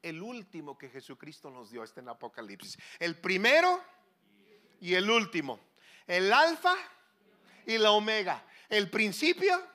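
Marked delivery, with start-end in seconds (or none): 3.0-9.7 loud, higher ringing proclamation; proclaiming. Acted. Preacher proclaiming Christ's titles, loud and higher. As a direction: ring out each title like a herald.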